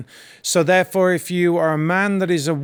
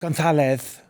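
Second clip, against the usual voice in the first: put-on Spanish accent; 3 semitones lower, slower than usual. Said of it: "because we have to say, like".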